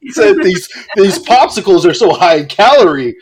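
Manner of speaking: high, loud, mock-innocent voice; hammed-up high-pitched politeness; feigned innocence. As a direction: cover up with exaggerated innocence. Acting out innocent cover-up to stranger.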